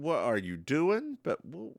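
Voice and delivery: ordinary talk, nothing marked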